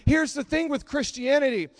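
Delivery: ordinary talk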